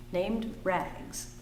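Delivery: plain reading voice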